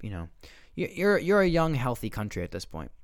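ordinary talk, nothing marked

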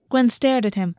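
ordinary delivery

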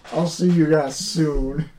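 slow drawling sign-off; leisurely folksy drawl. Acted. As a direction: stretch out the farewell in a drawl. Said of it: "I'm stuck on slow drawl".

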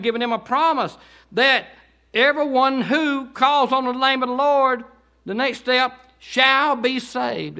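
high, loud fervent preaching; full-force preaching. Acted. Preacher urging the congregation, shouting fervently. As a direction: preach it with fire.

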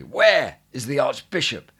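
loud, angry demand shouted across the church; furious cry. A narrator's reading. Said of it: "they cried out, the more furiously".

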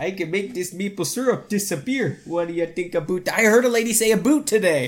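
high, hammed-up Canadian accent; mock Canadian accent. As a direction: tease with an exaggerated Canadian "boot". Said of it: "making fun of somebody saying a boot".

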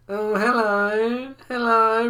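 slow, high, drawn-out hello; weird old customer. Acted. Odd customer greeting the shop staff, weird drawn-out voice. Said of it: "I'll do an impression of his voice".

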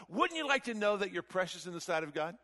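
normal talking voice

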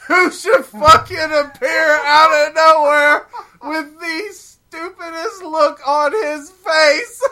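slow, loud and high, breaking with laughter; slowed, shouted disbelief through laughter; laughing excitement. Real, not acted. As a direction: build up the reveal, cracking up.

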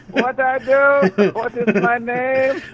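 high, slow put-on voice; mock waitress. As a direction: drag it out high like a confused waitress. Mimicking waitress's questions.

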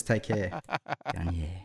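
soft low take-care and reply; quiet ad echo. Shown as a demonstration. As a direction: murmur the ad lines low. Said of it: "someone says".

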